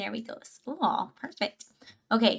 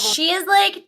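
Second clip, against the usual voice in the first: put-on Valley girl voice; 8 semitones higher, 4 dB louder than usual. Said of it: "You sound like a Valley girl".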